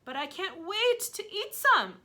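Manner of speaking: high, loud, slow greedy cry; greedy aunt crying out. Acted. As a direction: whine it, mouth watering. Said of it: "Aunt Sponge cried out".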